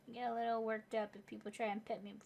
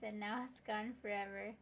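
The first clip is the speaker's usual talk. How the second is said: normal talking voice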